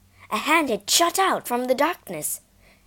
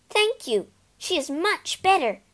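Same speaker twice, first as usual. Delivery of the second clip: cheerful; 8 semitones higher than usual. Acted. Banner message read gleefully.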